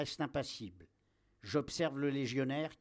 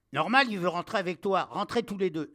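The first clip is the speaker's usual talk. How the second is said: loud, high officer's bark; shouted order; 4 semitones higher, 8 dB louder than usual. Acted. Captain sends soldier and dog into cave, shouting. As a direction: order both inside forcefully.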